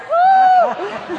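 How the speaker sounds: very high, loud startled whoop; panicked whoop. Retold or imitated. Advance staffer realizing she's at the wrong airport, loud panicked whoop. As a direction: yelp as the plan collapses. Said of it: "I was like".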